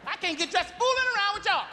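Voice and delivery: high, shrieking mom complaint; nagging mother. Retold or imitated. Mother says kids are making her late, exasperated. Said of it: "She always talking about we making her late".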